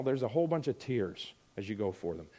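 usual speaking voice

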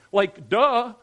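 loud sarcastic duh; tossed-off sarcastic jab; mock duh. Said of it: "he's not saying it like that".

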